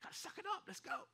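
high, squeezed, softened urging; retold self pep talk. Pastor psyching himself up for a wedding, high retold pep talk. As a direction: push yourself on in a high voice.

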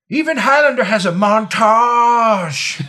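slow booming shouted movie line; movie quote shout. Retold or imitated. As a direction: belt it like a trailer voice. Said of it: "to quote the great, uh, Team America".

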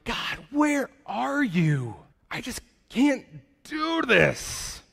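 slow, drained cry to God; slow despairing prayer from the pit; weary plea. Retold or imitated. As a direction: let it sag like someone at the end of their rope. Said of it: "you're like".

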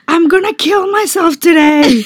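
high, loud mock yell; mock office outburst, loud and high; retold yell. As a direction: yell it across the office, hammed up. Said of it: "yelled at the entire office".

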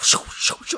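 rushing whooshes, one after another; airy whooshing sound effect; imitated vortex whoosh. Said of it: "It's just like".

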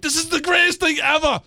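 high, elated retold yell; thrilled shout. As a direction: shout it out in over-the-top delight. Retold delight at disco lights.

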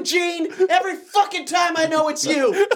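high, loud furious boss yell; angry boss. Pretend. Pretend manager yells at workers, angry. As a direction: yell at the staff over a botched order.

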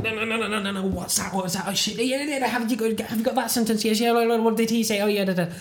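scrambling to catch every word; frantic inner panic. Retold or imitated. Brain panicking to follow the Flemish conversation, flustered.

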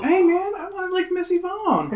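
very high, giggly protest; laughing joke. Real, not acted. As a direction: say it through laughter. Joking, laughing.

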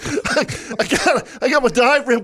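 laughing while telling it, high-pitched. Real, not acted. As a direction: talk through bursts of laughter. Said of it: "And I'm laughing so hard".

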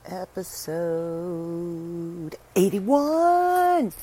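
high, loud, stretched announcement; game show announcer. Pretend. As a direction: call the number like a game show host. Announcing the episode number.

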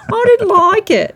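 high, loud, slow hammed-up English accent "I didn't like it"; posh English accent. Host performs a hammed-up English accent for the guest. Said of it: "such a beautiful English accent".

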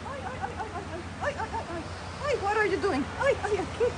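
high, soft cooing over a newborn. Real, not acted. Fussing over a newborn alpaca, high tender cooing.

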